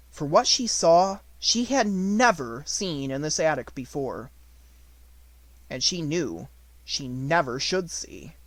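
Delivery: usual speaking voice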